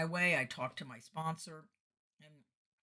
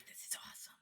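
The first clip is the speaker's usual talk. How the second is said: whispered inner cheer; hushed delight; 16 dB quieter than usual. Retold or imitated. Singer to herself after lucky offer, hushed delight. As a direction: whisper it to yourself, pleased.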